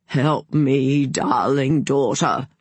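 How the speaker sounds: slow, hammed-up doddery plea; mock-feeble father, drawing out the words; quavering old man. A narrator's reading. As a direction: plead in a wobbly old man's voice. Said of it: "put on a quavering old man's voice".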